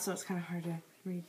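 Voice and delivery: fast, gum in the mouth; chewing gum. Real, not acted. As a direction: mumble through chewing gum. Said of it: "I have gum in my mouth".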